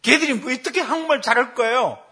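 put-on high, loud child's protest; mock girlish outburst; imitated daughter. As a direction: object indignantly as the daughter. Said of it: "그랬더니 우리 딸입니다".

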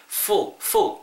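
"full" starting on a scraped f; scraped f sound. Shown as a demonstration. Teacher drilling the F sound, friction-led "full". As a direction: scrape teeth on lip into "full", no p. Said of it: "그때 긁으시고요".

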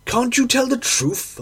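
high-pitched exasperated demand; exclaiming. A narrator's reading. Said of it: "I exclaimed".

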